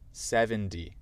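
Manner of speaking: American seventy, like seven-dee; American demo, T of seventy softened; American accent. Shown as a demonstration. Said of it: "avec mon accent américain et après".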